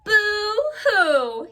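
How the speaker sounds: high hammed-up boo-hoo sob; fake-cry like a sad little beaver; playful pretend weeping; mock crying. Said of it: "he said".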